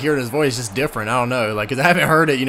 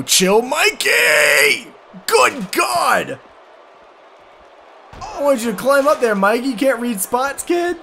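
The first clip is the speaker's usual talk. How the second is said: excited shouting; 10 semitones higher, slower than usual. Real, not acted. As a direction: holler at the game in disbelief. Yelling at the game.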